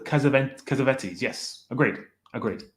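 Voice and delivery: normal talking voice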